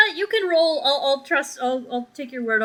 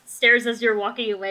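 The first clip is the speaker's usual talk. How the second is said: ordinary delivery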